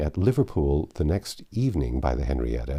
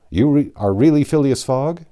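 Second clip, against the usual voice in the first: higher and louder, accusing question; sharp challenge; 7 semitones higher, 8 dB louder than usual. Acted. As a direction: corner him with it. Detective confronting Fogg sharply.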